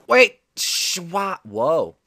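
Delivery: high startled yelp. Real, not acted. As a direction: caught off guard, shouting. Sudden fail in game.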